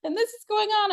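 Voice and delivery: sobbing high and breaking, voice cracking through tears; crying. Retold or imitated. Mother crying to her friend about her silent son. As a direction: get the words out while bawling. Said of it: "I just started bawling my eyes out".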